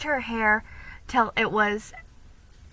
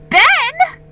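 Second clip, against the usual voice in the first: shocked; 13 dB louder than usual. Acted. Felicity shocked, happy.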